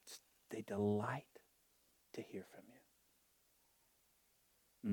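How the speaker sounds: unvoiced intimate whisper. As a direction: lean in and breathe it. Intimate whisper about God.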